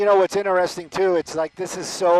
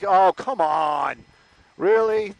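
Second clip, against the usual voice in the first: mock-nonchalant, drawn-out exasperation; 4 dB louder, slower than usual. Reporter voicing the driver's attitude during the standoff, nonchalant. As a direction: act out a couldn't-care-less driver, stretched out. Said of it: "Seems very nonchalant about it".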